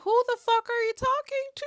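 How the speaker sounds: very high squeal of disbelief; exaggerated high-pitched affront; indignant comeback. Acted indignant comeback to the man.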